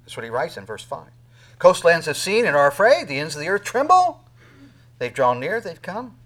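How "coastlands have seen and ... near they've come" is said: loud, raised scripture proclamation; proclaiming. Acted. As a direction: thunder the verse to the congregation. Preacher proclaims scripture loudly.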